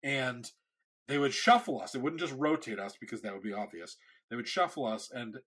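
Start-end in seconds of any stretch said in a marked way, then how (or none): none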